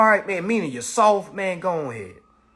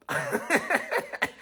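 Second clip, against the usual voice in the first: breathy laugh; 12 semitones higher, 7 dB quieter than usual. Real, not acted. Laughs at the absurdity.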